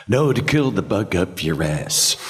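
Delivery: slow drawled movie-star impression; Jack Nicholson. Shown as a demonstration. Actor auditioning with a film line, slow star impression. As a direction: draw the line out, savouring it. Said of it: "to do a Jack, a Jack line".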